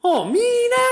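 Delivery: squeaky high pidgin voice; way up high, Hawaiian style; high up talk. Shown as a demonstration. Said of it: "like, the high voice".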